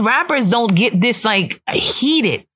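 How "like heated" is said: usual speaking voice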